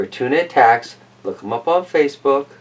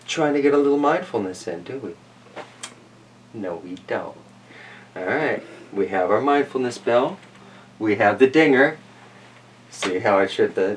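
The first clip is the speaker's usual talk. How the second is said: plain reading voice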